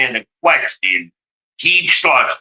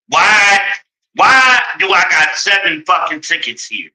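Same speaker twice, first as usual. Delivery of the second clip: furious shout; 5 semitones higher than usual. Real, not acted. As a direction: yell it out in disbelief. Furious about seven tickets.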